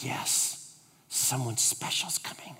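hushed secret whisper. Retold or imitated. Shop lady whispering to the visitor about a secret guest.

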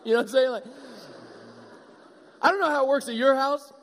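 ordinary talk, nothing marked

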